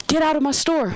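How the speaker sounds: high-pitched indignant manager; mock ordering out. Manager throws the customer out of the store, mock indignation.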